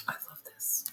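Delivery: breathy whisper to herself; whispered aside. Real, not acted. Reader delighted by a card, whispering to herself. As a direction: let it slip out under your breath. Aside while pulling tarot cards.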